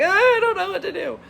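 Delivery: high, loud imitation of sobbing; mock crying wail. Recapper imitates the stressed woman waiting for him, mock crying wail. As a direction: wail it helplessly, hammed up. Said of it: "she's stressed out and crying".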